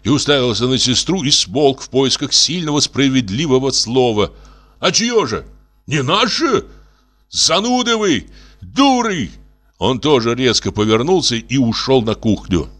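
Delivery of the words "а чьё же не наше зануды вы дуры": high-pitched exasperated insults; indignant shouting. A narrator's reading. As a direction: cry out in outrage, voice pitched up. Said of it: "закричал и Костя".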